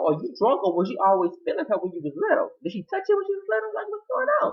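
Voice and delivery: very high-pitched disbelieving questions; incredulous. Acted. Viewer questions Wyatt's groping, incredulous squeal.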